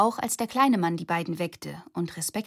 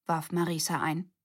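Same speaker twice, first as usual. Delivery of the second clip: plain reading voice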